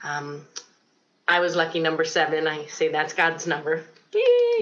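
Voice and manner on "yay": sudden high squeal of joy, much higher and louder; a playful little cheer; mock cheer. Mock cheer about lucky seven.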